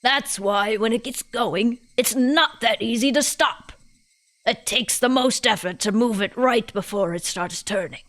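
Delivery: put-on British old lady explaining; old woman's lecture with a British lilt; old person, British. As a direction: voice a British granny holding forth. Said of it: "all my old people voices turn British".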